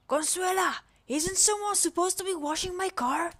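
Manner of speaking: high, loud, haughty lady calling out; bossy rich lady. Acted. Bossy employer calls the maid.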